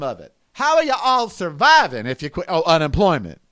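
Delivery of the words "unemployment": ordinary delivery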